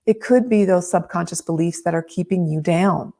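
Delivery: ordinary talk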